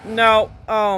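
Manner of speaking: horrified outburst at a spider; real disgusted alarm. Real, not acted. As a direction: recoil loudly from a spider, easing off by the end. Spider shows up on screen.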